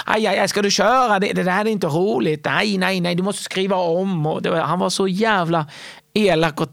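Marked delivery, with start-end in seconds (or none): 0.1-4.3 pitched-up scornful headliner tearing the jokes apart; Wahlbeck impression. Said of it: "Han bara". (hög och högljudd Wahlbeck-imitation, föraktfull)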